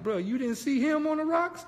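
high disbelieving squeak; shrill-pitched bro, you serious; incredulous. Retold or imitated. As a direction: ask in shocked disbelief. Incredulous question to friend.

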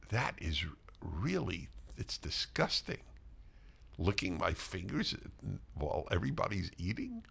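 half-whispered mortification, retold in a hushed low voice; embarrassed. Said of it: "he was so embarrassed".

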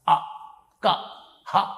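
"అ, క, హ" each said alone from the throat; throat sounds demonstrated. Shown as a demonstration. Guru shows where letters arise, "అ, క, హ" from the throat. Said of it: "ఇక్కడి నుంచి పలకాలి".

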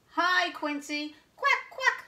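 high, excited duck greeting; shouting duck. A narrator's reading. Noisy duck greeting the quail, high shouted quacking. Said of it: "shouts Dante".

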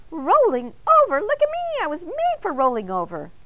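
a small voice showing off with delight; excited donut voice. A narrator's reading. Arnie the donut boasting to Mister Bing, high and excited.

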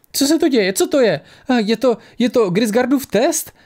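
put-on high, frightened girl stammering out questions; a terrified girl who just fell from the sky stammers. A narrator's reading. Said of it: "Koktala vyděšená dívka".

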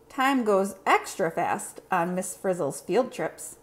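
usual speaking voice

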